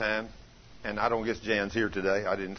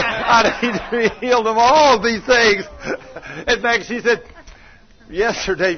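high, loud fervent preaching; full-force preaching; 5 semitones higher, 8 dB louder than usual. Real, not acted. Preacher tells the congregation the story, high, loud and fervent. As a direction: drive the story home with fire.

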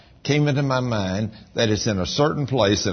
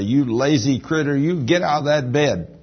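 spirit man scolding; 6 semitones higher, 4 dB louder than usual. Retold or imitated. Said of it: "your spirit man will say".

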